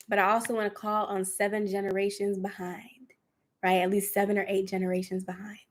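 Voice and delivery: soft unvoiced whisper; hushed meditation whisper; guided whisper. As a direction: whisper it like a healing prayer.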